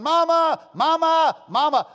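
put-on high, loud child's scream for mama; retold panicked scream for Mama. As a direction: shriek it like a stung little boy running home.

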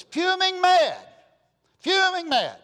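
high, loud preaching shout on fuming mad; preacher's loud outburst. Acted. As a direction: blast it out from the pulpit. Preacher bursts out on the anger.

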